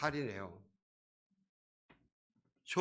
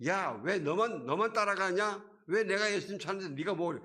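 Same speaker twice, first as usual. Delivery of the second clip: higher, louder angry comeback; angry retort; 6 semitones higher, 5 dB louder than usual. Retold or imitated. Bartimaeus hypothetically yelling angrily at the crowd, angry retort. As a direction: snap back at the crowd in anger. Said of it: "화가 나가지고".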